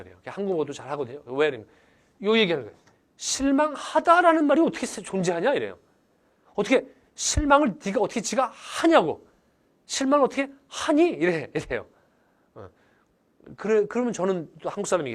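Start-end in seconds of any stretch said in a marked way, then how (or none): 3.2-5.5 high, loud retold protest; acting out a baffled friend's objection; incredulous. Friend's disbelief at Korean, retold. 6.5-11.1 high, loud mock protest; imitating a friend's baffled objection; incredulous. Friend's disbelief at Korean, retold.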